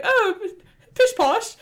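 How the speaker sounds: high, loud mock-British dismissal; pooh-pooh it in a hammed-up British voice; Prime's mock voice. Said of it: "that's the voice that he has".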